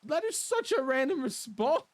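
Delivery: high, loud incredulous outburst; a loud, high outburst of disbelief. Real, not acted. Indignant at the Eight Ball.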